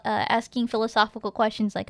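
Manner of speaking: usual speaking voice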